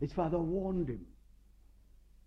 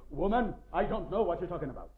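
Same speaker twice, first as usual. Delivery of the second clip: hasty denial; 5 dB louder, faster than usual. Retold or imitated. Peter denying.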